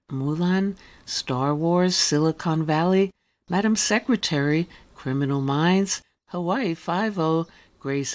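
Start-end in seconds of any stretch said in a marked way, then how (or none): none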